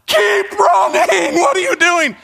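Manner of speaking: high, stretched-out holler; frantic screaming. Retold or imitated. Parent urging a daughter who slows mid-race, frantic yell. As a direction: yell it in disbelief across the track. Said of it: "my voice just screaming".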